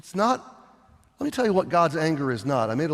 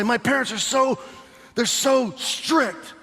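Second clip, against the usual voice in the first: put-on whiny teen; 7 semitones higher than usual. A teen complaining to the youth pastor, whiny. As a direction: whine like a fed-up kid. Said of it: "a kid would come to my office and say".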